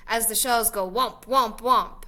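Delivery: usual speaking voice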